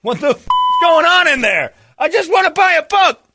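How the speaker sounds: high, whiny frustrated shopper; high exasperated customer. Acted. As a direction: whine it up high like a fed-up customer.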